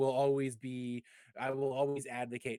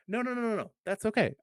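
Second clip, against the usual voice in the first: reassuring; 10 semitones higher than usual. Retold or imitated. Friend cheerfully reassures in story.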